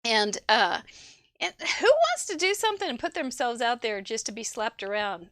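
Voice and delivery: ordinary talk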